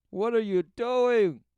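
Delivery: high, loud, slow cry of alarm; the spirit calling out. Acted. Commentator voicing the inner spirit crying out, high and loud. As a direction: call out like a startled inner spirit. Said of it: "it shocks the spirit".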